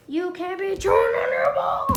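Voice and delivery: loud, high scolding of the dog; scolding the dog for chewing its ball, shouted. Real, not acted. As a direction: yell at the dog to stop chewing.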